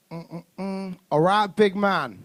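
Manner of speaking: put-on a light teen swagger, slow and drawn out; slow, unimpressed boy sizing up the dad; lightly voiced cocky kid. Boy acting cool to dad.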